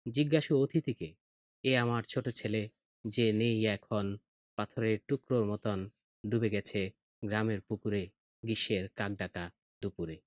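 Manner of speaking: slow grieving recitation. Shown as a demonstration. Father showing the guest his drowned son's photo, quiet halting recitation. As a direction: recite the dead son's memory slowly, letting it fade. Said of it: "কিভাবে থেমে থেমে অক্ষরবৃত্তটা হয় সেটা দেখো".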